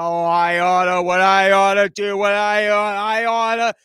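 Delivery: loud, drawn-out cartoon grumbler; old cartoon bluster. Drunk men making clubhouse speeches, old cartoon bluster. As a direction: bluster like a cartoon blowhard, winding up empty threats. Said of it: "like in those old cartoons".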